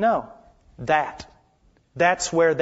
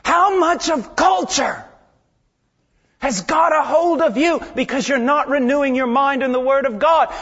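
rebuking students; 8 semitones higher, 6 dB louder than usual. Retold or imitated. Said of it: "I look at them and I say".

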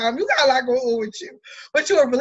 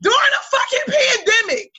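exasperated disbelief; 7 semitones higher than usual. Real, not acted. Telling friends about bumping into her ex in Lowe's, high outraged disbelief. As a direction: rant in disbelief at the bad luck.

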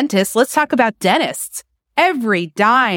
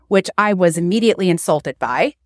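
ordinary talk, nothing marked

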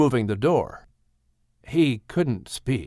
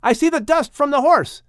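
high call from far off; shouted; 13 semitones higher, 3 dB louder than usual. A narrator's reading. Boy at the upper window shouting down to family, high. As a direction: call out high to the ones below. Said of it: "shouted Japheth from the upper window".

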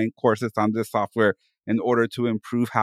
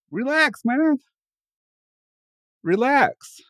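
much higher, light voice telling her to relax; calming Jesse; 11 semitones higher, slower than usual. Retold or imitated. Jesse telling her to relax and have fun, high mock voice. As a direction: talk her down gently in a put-on voice. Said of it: "And Jesse's like".

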